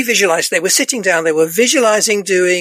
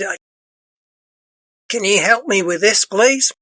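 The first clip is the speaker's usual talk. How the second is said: a lightly put-on Australian accent, rising on please; faint hint of an Australian accent asking for help; bad Australian accent. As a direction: quote the Australian client in a half-hearted Australian accent. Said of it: "I do a bad Australian accent".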